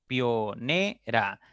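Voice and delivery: "Pionera" very slow, as pio, ne, ra; syllable by syllable. Shown as a demonstration. Teacher counting three syllables, "Pionera" slowly broken apart. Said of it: "forman un diptongo y no puedo separarlas".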